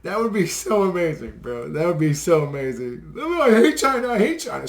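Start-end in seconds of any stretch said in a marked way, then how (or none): 3.2-4.7 high, loud put-on whiny voice; mock beaten politician. Beaten Trudeau recanting under punches, high whiny put-on voice. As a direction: whine it out like a cornered weakling.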